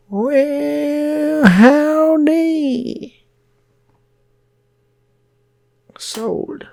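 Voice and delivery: very high, loud drawn-out howdy; Southern howdy. Retold or imitated. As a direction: sing out the greeting like a cowboy. Shopkeeper voice again.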